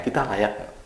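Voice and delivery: doubtful inner voice. Retold or imitated. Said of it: "pikiran kita".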